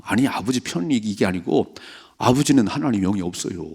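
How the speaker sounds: normal talking voice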